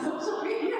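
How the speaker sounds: put-on high, laughing slip into Arabic accent. Joking about falling into the trap, laughing Arabic accent. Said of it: "I get Arabic accent".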